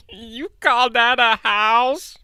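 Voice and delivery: high, slow drawn-out sneer; slow mocking T-Rex. T-Rex mocks the branch house, slow high sneer. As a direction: stretch out the taunt like a gloating monster. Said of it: "The T-Rex roared".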